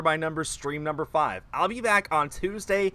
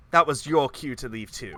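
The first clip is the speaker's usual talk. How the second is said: ordinary talk, nothing marked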